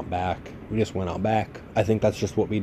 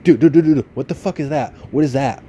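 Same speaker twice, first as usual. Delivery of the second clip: imitating a panicked cousin, higher and louder; frantic alarm; 5 semitones higher, 7 dB louder than usual. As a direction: retell the cousin's scared, urgent dude dude dude. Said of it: "starts frantically hitting me on the arm".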